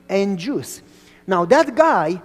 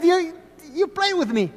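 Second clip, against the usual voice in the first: whiny complaint; 9 semitones higher than usual. Retold or imitated. Boy frustrated with vendor.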